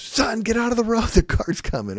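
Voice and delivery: high, sudden mock warning call. Shown as a demonstration. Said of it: "a time to raise your voice".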